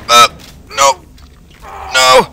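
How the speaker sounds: high, loud panicked no; panicked scream. Real, not acted. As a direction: scream in terror. Chased by monsters.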